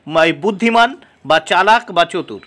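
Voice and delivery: ordinary talk, nothing marked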